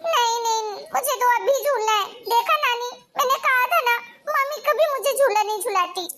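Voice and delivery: put-on a little boy crying to grandma about his aunt; whiny crying child. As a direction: sulk and whimper like a refused kid. Child refused, whines and cries.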